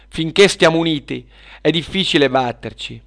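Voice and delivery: rally the friends with a loud voice. Acted. Girls give each other courage after the fight, rallying. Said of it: "si fecero forza le bambine".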